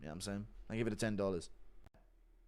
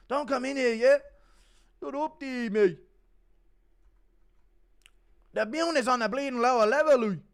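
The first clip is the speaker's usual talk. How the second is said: much higher, louder Irish brogue; Conor McGregor impression; 12 semitones higher, 10 dB louder than usual. Thanking a fan who praised the impression, loud Irish rant. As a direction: rant like a cocky Dublin fighter. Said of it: "Your Conor McGregor impression is godly".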